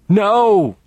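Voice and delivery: high, loud desperate scream. A narrator's reading. Priest screaming at the sight, terrified scream. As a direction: scream it out in terror. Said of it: "He screamed".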